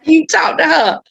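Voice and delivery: high, emphatic mock telling-off; playful scolding. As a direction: press the point like a comic auntie. Acted retort to reluctant patient.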